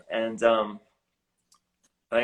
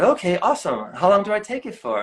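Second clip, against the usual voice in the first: eager reply; 10 semitones higher, 5 dB louder than usual. Retold or imitated. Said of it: "And I said".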